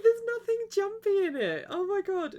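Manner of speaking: high, wailing mock exasperation; exasperated whine. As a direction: whine it up high like you can't believe it. Quoting her own exasperated reaction.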